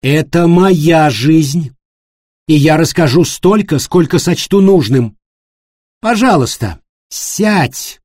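loud, slow, firm command. A narrator's reading. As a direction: insist on her own terms.

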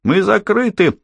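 very high barmaid's mock yell; woman's shout. A narrator's reading. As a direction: turn the customer away in a high scolding cry. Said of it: "крикнула женщина у неё за спиной". (высокий женский окрик)

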